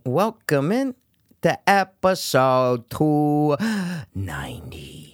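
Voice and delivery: podcast host intro. Acted. Host opens new episode.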